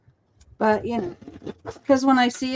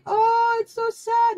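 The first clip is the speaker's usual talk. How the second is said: very high, weepy retold wail; hammed-up tearful squeak; mock bawling; 11 semitones higher, slower than usual. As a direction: imitate yourself blubbering at a movie. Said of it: "I'm bawling".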